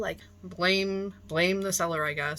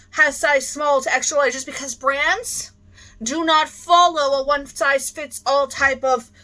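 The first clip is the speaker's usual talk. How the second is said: heated rant; 6 semitones higher, 4 dB louder than usual. Real, not acted.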